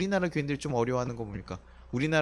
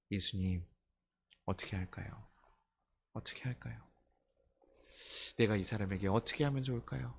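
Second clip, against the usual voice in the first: low, soft acted prayer; hushed, humble pleading with pauses; quiet prayer; 5 semitones lower, 7 dB quieter than usual. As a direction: ask softly, as if praying privately. Acting out a prayer to Jesus.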